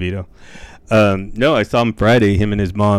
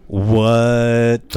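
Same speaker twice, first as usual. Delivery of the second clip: drawn-out, louder disbelief; drawn-out shock; 3 semitones higher, 5 dB louder than usual. Real, not acted. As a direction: stretch it in stunned disbelief. Mock shock at the news.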